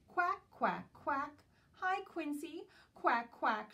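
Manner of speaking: loud, high, quacking duck shout. Acted. Dotty Duck greeting her friend Quincy, loud quacking shout. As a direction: belt it out like a noisy duck. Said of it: "shouts Dotty".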